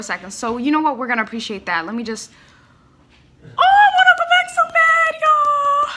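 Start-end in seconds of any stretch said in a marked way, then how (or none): 3.4-5.9 high, loud fan wail; overwhelmed excitement. Real, not acted. Fan begging to replay a loved song, overwhelmed. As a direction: wail it in sheer delight.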